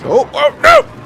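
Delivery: sudden high panicked no; surprised shout. Real, not acted. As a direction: yelp it in alarm.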